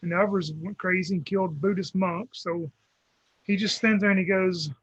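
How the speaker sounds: ordinary talk, nothing marked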